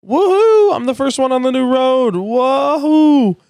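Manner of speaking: yelling with joy on the new road; Mater whooping. Acted. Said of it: "Mater is seen beside it".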